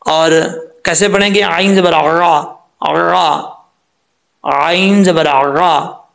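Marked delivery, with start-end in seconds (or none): 1.4-6.0 आइन ज़बर रा slowly, ayn squeezed from mid-throat; clear ayn. Shown as a demonstration. Teacher drilling ayn from the throat, slow clear articulation. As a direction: voice रा as a throat-made "a", not r. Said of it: "आइन को clear करना है".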